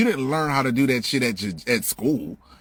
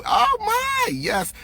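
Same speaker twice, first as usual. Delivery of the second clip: mock teacher; 8 semitones higher than usual. Mocking kindergarten teacher again.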